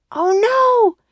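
high, loud cry of alarm; dismayed cry. A narrator's reading. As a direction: cry out in horror. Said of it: "cried Annie".

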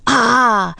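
high, loud battle cry; short storybook war cry; battle shout. A narrator's reading. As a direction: yell it as you charge. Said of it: "Jack and Annie shouted".

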